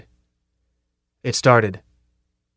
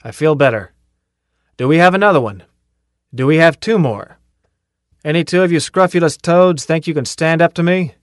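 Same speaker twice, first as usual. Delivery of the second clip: loud, higher calling out to the whole company; 5 semitones higher, 5 dB louder than usual. Acted. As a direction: shout the dare across the ranks, pushing louder. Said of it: "called out".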